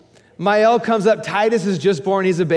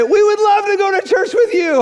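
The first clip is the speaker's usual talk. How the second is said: high loud eager voice; eager neighbors; 5 dB louder than usual. Retold or imitated. Neighbors accepting the church invite, high and eager. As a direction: say it eagerly, bright.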